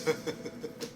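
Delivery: short chuckle after a boast; libertine's chuckle; stage laugh. Acted. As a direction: let out a brief self-pleased laugh. Don Juan laughs at his boast.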